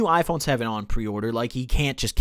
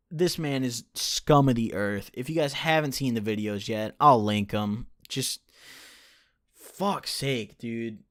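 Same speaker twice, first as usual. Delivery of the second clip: ordinary delivery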